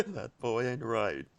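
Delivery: low, slow, soft cartoon-dad lament; Hank Hill. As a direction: drop down low and drag it out, disappointed dad. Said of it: "more Hank Hill quotes".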